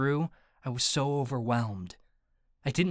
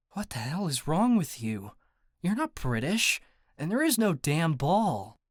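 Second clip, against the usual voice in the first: higher-pitched, incredulous outburst; exasperated; 4 semitones higher than usual. A narrator's reading. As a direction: snap back in sheer disbelief. Exasperated imagined retort to delusion.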